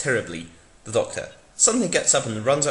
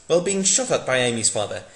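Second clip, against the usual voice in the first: ordinary talk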